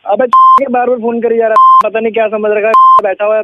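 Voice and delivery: ordinary talk, nothing marked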